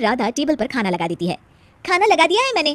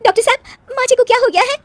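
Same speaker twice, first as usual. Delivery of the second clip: panicked; 12 semitones higher, 6 dB louder, faster than usual. Acted. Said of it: "घबराई हुई राधा तुरंत doctor को बुलाती है".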